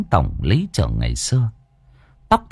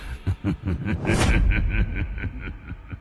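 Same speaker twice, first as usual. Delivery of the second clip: eerie ghost laugh; 7 dB quieter than usual. A narrator's reading. Ghost laughs after summoning.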